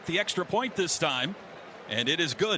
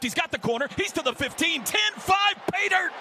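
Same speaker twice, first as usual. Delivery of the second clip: touchdown call; 11 semitones higher than usual. Real, not acted. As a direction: voice climbing all the way to the end zone. Touchdown run called live.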